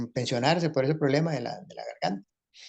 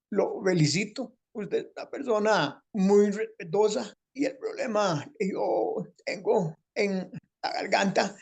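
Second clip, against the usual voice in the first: high, slow, strained voice with a catch; a grateful man in a high strained voice; Rafael Guillén's changed voice; 6 semitones higher, slower than usual. Retold or imitated. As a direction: speak slowly in a pinched high throat voice. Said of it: "el cambio de voz".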